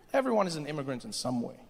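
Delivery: ordinary delivery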